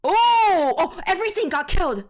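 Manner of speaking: loud, high-pitched "oh" easing slightly into amazement; astonished outcry. Real, not acted. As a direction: exclaim in real shock at the wipeout. Stunned by cards being wiped out.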